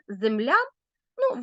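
ordinary talk, nothing marked

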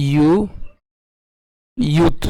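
ইউ, then ইয়ুথ, as separate pieces with a y glide; broken-down pronunciation. Shown as a demonstration. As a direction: say ইউ, pause, ইয়ুথ, ending on t. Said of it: "তাহলে এটার উচ্চারণ কেমন হবে?".

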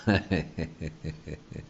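light happy chuckle; a small delighted laugh; quiet laugh of joy; soft chuckle. Real, not acted. Laughs at holy ghost grin.

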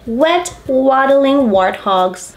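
plain reading voice

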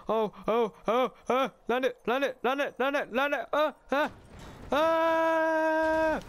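rising high frantic chant to scream; building from pleading to a yell; excited yelling. Real, not acted. Car jump mid-air.

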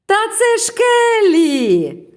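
very high, loud cartoon cry; slow, stretched, high-pitched shout; answering friends. A narrator's reading. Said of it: "відповіли друзі".

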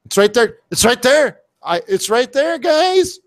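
thrilled, pointing it out; excited. Real, not acted.

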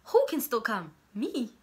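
sarcastic comeback. Retold or imitated. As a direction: fire it back sarcastically, acting baffled. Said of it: "And I'm like".

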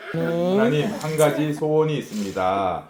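steady heartfelt praying voice; earnest prayer. Real, not acted. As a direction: pray sincerely for the family. Leads family prayer. (간절한 기도하는 목소리)

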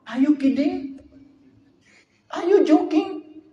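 high, loud incredulous voice; mocking disbelief. Bani Israel mocking Musa's order, high incredulous scoff. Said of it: "Bani Israel kata apa".

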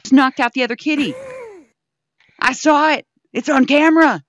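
excited laughing report. Real, not acted. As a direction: blurt it out thrilled.

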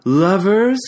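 mocking echo rising to a loud, high jeer; mocking repetition building to a jeering shout; raucous mocking. A narrator's reading. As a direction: throw his word back at him in scorn. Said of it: "she mocked me raucously".

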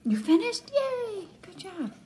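high, bright cheer for finishing; excited praise. Real, not acted. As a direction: cheer him on like a proud mom. Mom praising son's schoolwork.

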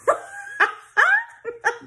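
high squealing laugh; big laugh. Real, not acted. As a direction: burst out laughing. Laughs at own joke.

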